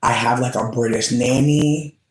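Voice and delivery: ordinary talk, nothing marked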